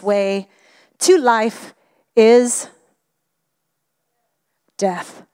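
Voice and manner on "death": ordinary talk, nothing marked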